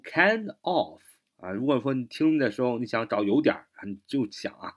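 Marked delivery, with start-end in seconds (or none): 0.0-1.0 "kind of" with both words sounded out; full unreduced form. Shown as a demonstration. Teacher shows what natives don't say, full "kind of". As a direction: pronounce "kind of" fully, keeping "of" distinct. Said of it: "就不读".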